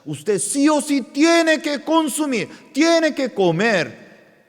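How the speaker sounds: preaching. Acted. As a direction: drive each phrase home to the congregation. Pastor preaching to congregation.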